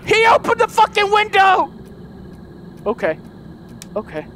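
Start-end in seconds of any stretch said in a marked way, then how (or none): 0.1-1.7 alarmed yelling. Real, not acted. Enemy opened the window, gamer yells.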